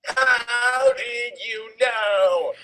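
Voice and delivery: slow, drawn-out mock surprise; surprised. Reading Ebony questioning Voldemort, slow mock surprise. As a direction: stretch the question out in hammed-up shock. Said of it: "I asked in a surprised way".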